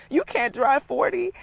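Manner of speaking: loud, slow, laughing disbelief; laugh, then spell out the absurdity; exasperated laugh. Real, not acted. Telling ladies about lazy Dallas friends, laughing exasperation.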